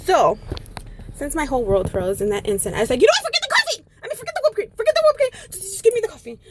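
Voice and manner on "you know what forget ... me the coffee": very high-pitched panicky squeal; frantic giving-up. Retold or imitated. Late customer abandoning the whipped cream, frantic. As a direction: squeal it, desperate to get away.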